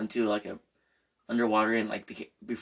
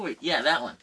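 usual speaking voice

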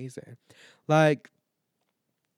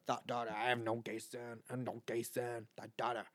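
lower-pitched imitation of a man from a video; viral video man; 4 semitones lower, 9 dB quieter than usual. Host quoting a favourite TikTok video, imitating the man. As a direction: quote the TikTok man, low and deliberate. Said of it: "That man right there on that video".

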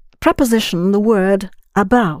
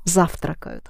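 завтракают with the first syllable accented; stress on first syllable; 4 semitones lower, 7 dB quieter than usual. Shown as a demonstration. Teacher corrects student's word, stress on first syllable of завтракают. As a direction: stress the opening ЗАВ of завтракают. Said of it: "the first syllable accented".

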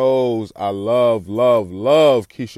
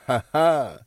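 short, light jiggly chuckle; little jiggle laugh. Shown as a demonstration. Showing his own little laugh to listeners, light jiggle laugh. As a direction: give a quick playful jiggle laugh. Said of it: "That's my little jiggle laugh".

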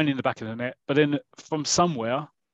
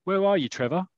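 raised-pitch call to a teammate; restrained on-pitch shout; mock shout; 6 semitones higher than usual. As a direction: call out higher, without full volume. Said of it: "to shout out".

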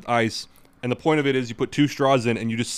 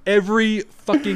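emphatic; 9 semitones higher, 7 dB louder than usual. Real, not acted. Outraged rant starts.

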